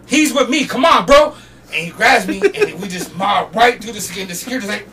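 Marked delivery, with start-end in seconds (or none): 0.1-1.3 loud, raised retold insistence; insistent vouching. Roadie arguing with security guard, insistent. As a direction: push back loudly for a friend.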